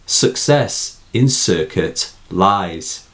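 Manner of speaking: Success, circuit read slowly with the S sounds drawn out; slow recited line. Shown as a demonstration. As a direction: lean on every S in Success and circuit. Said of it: "Sibilance, repetition of the S sound".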